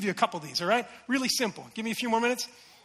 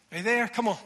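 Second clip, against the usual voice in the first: ordinary delivery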